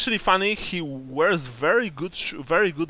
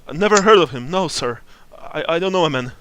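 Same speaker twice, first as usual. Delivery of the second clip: terrified; 3 semitones higher, 8 dB louder, faster than usual. Acted. Frightened NPC denying knowledge.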